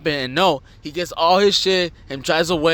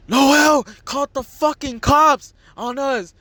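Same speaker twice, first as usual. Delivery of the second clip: high, drawn-out mock wail; upset girl; 11 semitones higher, slower than usual. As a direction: act out an upset girl reporting to grandma. Retold upset girlfriend telling her grandma.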